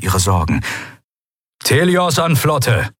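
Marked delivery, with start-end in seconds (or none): 1.6-2.9 high, slow hail to the fleet; radio message. A narrator's reading. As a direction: send a drawn-out radio call. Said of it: "kam die Nachricht verrauscht und knisternd".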